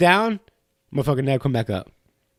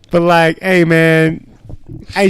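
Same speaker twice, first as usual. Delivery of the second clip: ordinary talk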